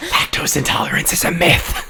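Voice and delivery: breathy whispered rat line. Acted. A rat whispering its creed to the visitors.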